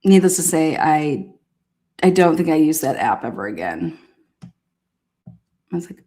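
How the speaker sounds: ordinary talk, nothing marked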